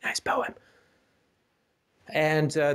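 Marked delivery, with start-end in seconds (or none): 0.0-0.5 soft whispered confession; whispered aside. Real, not acted. Admitting to the co-host he likes the poem, whispered.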